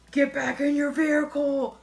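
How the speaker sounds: loud commanding police order; stern cop shout. Retold or imitated. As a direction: bark it like a cop. Cop orders her back in.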